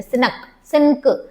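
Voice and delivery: सिनक drawn out slowly as sin-nak; wrong split pronunciation. Shown as a demonstration. Teacher demonstrates the mistake before saying no, slow split सिनक. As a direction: slowly break सिनक apart, sounding न and क separately. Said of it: "no, सिंग".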